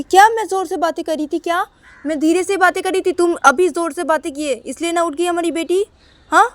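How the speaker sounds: wife retorts to husband in a loud quarrel; angry retort. Acted. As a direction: throw the blame back hard.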